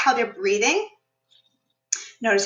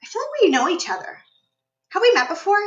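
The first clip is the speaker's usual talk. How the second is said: curious stranger; 7 semitones higher than usual. Pretend. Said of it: "be like".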